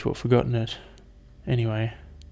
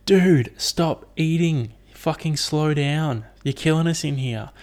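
higher, drawn-out complaint from the gut; big fart talking; 5 semitones higher, slower than usual. Retold or imitated. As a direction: plead slowly as an overloaded gut's fart. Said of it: "farts are like".

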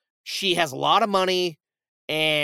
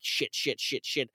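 quiet, low panicked muttering; retold freak-out; 5 semitones lower, 9 dB quieter than usual. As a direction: mutter curses under your breath. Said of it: "he's freaking out".

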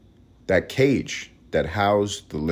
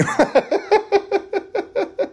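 high laugh; 3 dB louder than usual. Real, not acted. Laughing after outrageous remark.

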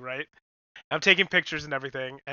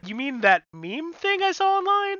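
mock incredulous self; 12 semitones higher than usual. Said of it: "And I was all like".

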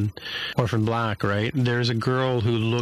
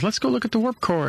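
much higher, upbeat friend voice; eager invitation; 12 semitones higher than usual. Retold or imitated. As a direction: invite her along cheerfully. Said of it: "Tendi says".

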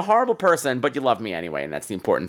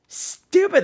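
"Stupid" said much higher, falling; a host exasperated at callers, with a high falling "Stupid"; high exasperated repeat. Shown as a demonstration. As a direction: fling "Stupid" up high in disbelief.

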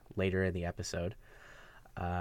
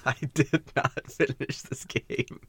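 slow admission through real laughter; laughing confession; 4 semitones higher than usual. Real, not acted. Co-host confesses to not finishing the game, laughing. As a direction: draw it out while cracking up.

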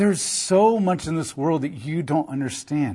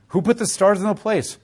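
normal talking voice

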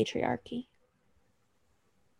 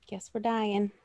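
resigned; 9 dB quieter than usual. Acted. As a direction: say it small, as if giving up. Imagined resigned reaction, then repeated bigger.

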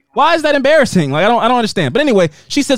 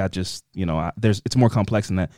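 low, fast, fading lecture of a film snob; lightly voiced know-it-all film student; snobby film kid; 9 semitones lower, 4 dB quieter than usual. Mocking a classmate to a friend, hint of a snob. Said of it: "a kid in the class who was like".

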